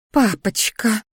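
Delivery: pouting "Папочка", lips pushed out. A narrator's reading. Daughter pouting to her father, wheedling. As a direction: wheedle like a sulky child. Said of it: "надула губы Криштия".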